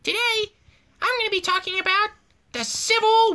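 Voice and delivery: a goofy teacher opening class; mock teacher host. Mock teacher show opener.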